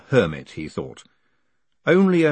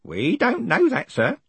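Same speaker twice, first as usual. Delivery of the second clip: put-on a fussy old servant, higher pitched; old postman voice; 12 semitones higher than usual. A narrator's reading. Narrator voices Grote.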